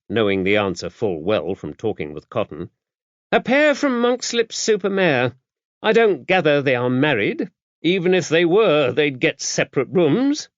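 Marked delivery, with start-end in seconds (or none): 3.3-10.5 high-voiced, brisk, no-nonsense abbess; a worldly, gruff nun laying down the rules; gruff old abbess. A narrator's reading. Dame Tabitha briefing the visiting priest, gruff and brisk.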